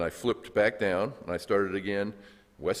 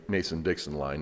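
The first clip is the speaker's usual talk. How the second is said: ordinary talk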